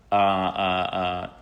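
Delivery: slow, stretched uh uh uh; hammed-up stalling; drawn-out hesitation. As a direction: drag out the hesitation like a creepy stranger. Stranger hesitating over the offer.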